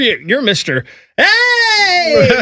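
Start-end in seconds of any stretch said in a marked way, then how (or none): 1.2-2.4 long, high mock-excited holler; imitated excitement. Teasing a co-host as Mister Hey, hammed-up excited holler. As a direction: imitate an over-excited greeter, stretched out. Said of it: "And all excited".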